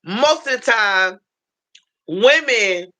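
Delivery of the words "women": ordinary talk, nothing marked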